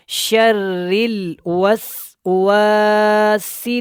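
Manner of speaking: drawn-out chanted tajweed recitation; slow Quran recitation. Shown as a demonstration. Tajweed teacher demonstrating recitation to students, slow chant. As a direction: intone each word slowly, melodically.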